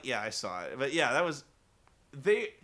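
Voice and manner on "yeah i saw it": deadpan unimpressed. Retold or imitated. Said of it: "And I'm like".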